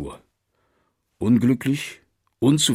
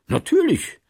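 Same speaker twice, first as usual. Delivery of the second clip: a startled, much higher natürlich; surprised. A narrator's reading. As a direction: caught off guard, pitch jumping up. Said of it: "sagte Martin überrascht". (überrascht, mit hoch springender Stimme)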